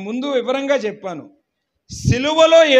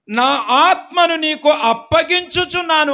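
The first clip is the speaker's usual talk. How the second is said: higher, louder solemn cry; Jesus on the cross, raised solemn cry; 6 semitones higher, 4 dB louder than usual. A narrator's reading. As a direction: proclaim it like the last words on the cross. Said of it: "బిగ్గరగా కేకవేసి".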